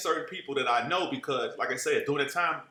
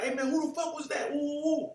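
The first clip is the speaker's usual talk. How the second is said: mock hyped crew; 12 semitones higher than usual. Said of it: "hopped out the car like".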